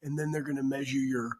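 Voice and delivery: hushed, quiet voice; whispering. Real, not acted. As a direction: keep it down, half whispered. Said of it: "Why are you whispering?".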